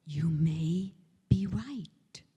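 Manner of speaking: very slow, measured and low; deliberate delivery. Shown as a demonstration. Childfree person looking into the arguer's eyes, slow and deliberate. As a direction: draw out each word with weighty pauses. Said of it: "you look into their eyes, and you say this".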